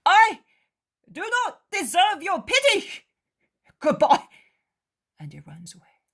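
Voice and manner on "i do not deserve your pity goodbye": high, loud, abashed apology; contrite young man. Acted. Judas correcting his rudeness before running off. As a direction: take it back, ashamed. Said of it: "he rectifies himself".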